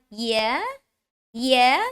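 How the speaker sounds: YEAH rising like a question; questioning upward YEAH; rising tune. Shown as a demonstration. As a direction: lift "yeah" upward each time. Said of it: "you go up".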